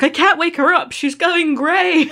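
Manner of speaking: high-pitched frantic phone call; mock panic. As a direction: wail it in hammed-up alarm, breaking into laughter at the end.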